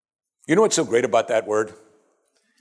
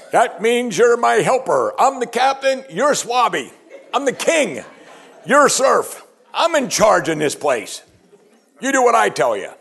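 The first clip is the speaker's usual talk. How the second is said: high, loud mocking swagger, laughing through it; bossy husband; 12 semitones higher, 5 dB louder, slower than usual. Preacher mocking men lording over wives, hammed-up bossy swagger. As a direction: lord it over the wife in mock pomp. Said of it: "you know what men did with that verse".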